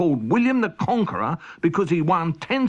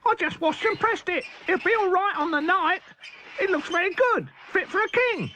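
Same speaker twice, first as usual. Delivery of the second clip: Chris Rabbit. Acted. Chris Rabbit answers proudly.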